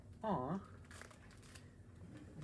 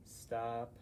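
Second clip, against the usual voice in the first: ordinary delivery, nothing marked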